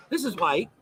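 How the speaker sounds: normal talking voice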